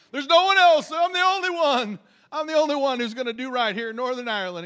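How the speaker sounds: high, loud, whining self-pity; mock victim whine. Preacher mocks a lone believer's whining, high and loud.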